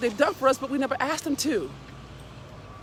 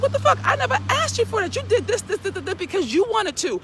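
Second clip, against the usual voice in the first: much higher, rising into a loud indignant cry; indignant outburst; 11 semitones higher, 4 dB louder than usual. Retold or imitated. Speaker replays her answer to a friend keeping score: loud outraged cry. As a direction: fling it back at them, outraged. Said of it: "And I'm like".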